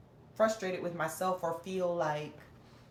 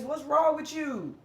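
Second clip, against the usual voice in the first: harsh; 7 semitones higher, 5 dB louder, slower than usual. Retold or imitated. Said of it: "they don't never say it in, like, a kind and loving way".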